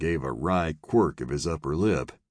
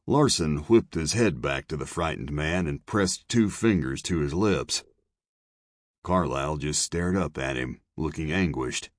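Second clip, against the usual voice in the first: plain reading voice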